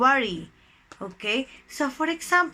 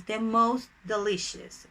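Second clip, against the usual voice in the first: ordinary delivery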